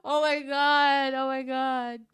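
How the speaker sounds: overwhelmed with glee; excited squeal. Real, not acted. Reacting, giddy.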